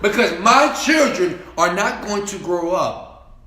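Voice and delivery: loud, defiant vow; preaching shout. Acted. Father vowing to build a legacy for his two children, shouting loud. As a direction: roar it like a man making an oath.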